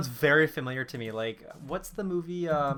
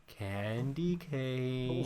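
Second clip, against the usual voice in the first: softly drawled villain call over the radio; creepy trucker voice; 6 dB quieter, much slower than usual. Retold or imitated. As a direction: draw it out like a menacing radio caller. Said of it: "and he goes".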